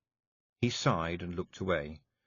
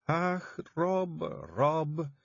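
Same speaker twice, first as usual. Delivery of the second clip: starting to cry; 8 semitones higher, much slower than usual. Acted. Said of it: "said Jeanie, beginning to cry".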